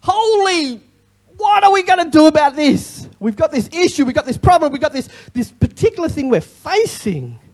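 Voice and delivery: like someone overwhelmed by a problem; panicked cry. Acted. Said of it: "put some other words in there".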